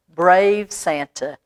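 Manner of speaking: usual speaking voice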